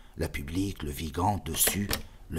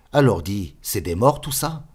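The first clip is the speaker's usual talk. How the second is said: higher and louder, urgent; startled question; 3 semitones higher, 8 dB louder than usual. Acted. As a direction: ask it with alarm. (question inquiète, plus aiguë et forte)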